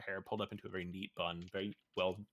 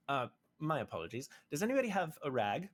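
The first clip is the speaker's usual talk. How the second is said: battered elf; 7 semitones higher, 4 dB louder than usual. Acted.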